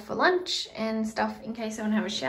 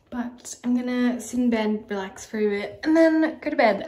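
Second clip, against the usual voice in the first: talking around a retainer; wearing a retainer; 8 dB louder than usual. Real, not acted. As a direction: retainer in, slightly slurred. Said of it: "I have my retainer".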